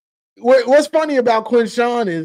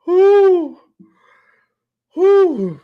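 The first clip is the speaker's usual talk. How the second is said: winded whew; 7 semitones higher, 5 dB louder than usual. Real, not acted. After hard laughing.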